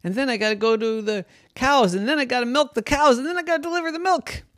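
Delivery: fast, climbing, breathless list; speeding up to a high frantic rush. Acted. Panicked plan, speeding up.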